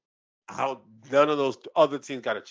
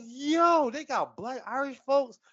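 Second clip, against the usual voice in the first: much higher, excited disbelief; amazed reaction; 10 semitones higher, slower than usual. Retold or imitated. Host retelling his Olympics surprise, higher amazed voice. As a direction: retell the shock with a lifted voice. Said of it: "I was like".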